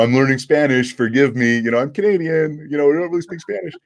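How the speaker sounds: high-pitched imitation of his own stage apology; mock apologetic plea. Comic translating his apology to the cruise audience, mock pleading.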